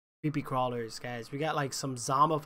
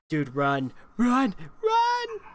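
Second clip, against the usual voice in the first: panicked, rising yells to run; being chased; 7 semitones higher, 6 dB louder, much slower than usual. Real, not acted. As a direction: scream at them to run, higher each time. Fleeing hungry dogs in game.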